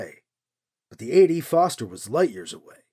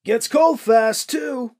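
higher, louder slow warning called out; 7 semitones higher, 5 dB louder, slower than usual. A narrator's reading. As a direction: draw out the warning across the distance. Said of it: "the man called".

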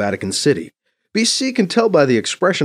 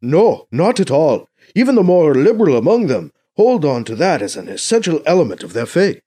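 higher, louder troubled negotiator; emphatic Mbeke; 7 semitones higher, 6 dB louder than usual. Acted. Mbeke explaining the failed talks to BC, emphatic. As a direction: argue it out with raised pitch and force.

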